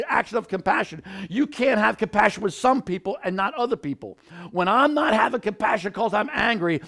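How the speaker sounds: loud, high fervent preaching; preaching at full force. Acted. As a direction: preach it out with fire.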